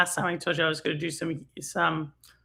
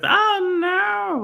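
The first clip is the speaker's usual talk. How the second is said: hammed-up whimpering oh no, pitched way up; mock wail; 12 semitones higher, 5 dB louder than usual. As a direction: play it like a fake little cry. Mock dismay at daughter's tennis.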